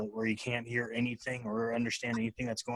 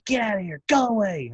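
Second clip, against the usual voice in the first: higher mock-angry little girl shouting; imitating angry toddler; 8 semitones higher, 3 dB louder than usual. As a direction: mimic a mad toddler telling dad off.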